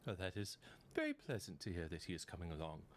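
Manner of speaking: lightly voiced prim halfling, pleased; a polite little halfling, mildly put on. GM as halfling answering the party, lightly voiced.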